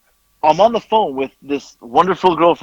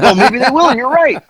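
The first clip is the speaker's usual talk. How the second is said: mock agreement; 11 semitones higher, 5 dB louder than usual. Mock agreeing while laughing together.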